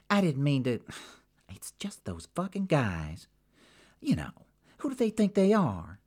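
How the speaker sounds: vent, then flare up on the question; Jackie, sore. Acted. Jackie to Sabina, griping about the rude men.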